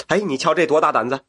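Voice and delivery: ordinary delivery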